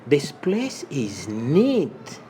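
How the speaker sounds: slow and drawn out; slow model phrase. Shown as a demonstration. Teacher slowly modeling the phrase for learners. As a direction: stretch each word for the class. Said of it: "slow model phrase".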